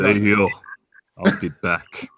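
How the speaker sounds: put-on low, Austrian-accented action-hero voice; deep Austrian accent, clipped lines; Arnold Schwarzenegger impression. Asked for Arnold, low Austrian accent. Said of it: "do Arnold Schwarzenegger".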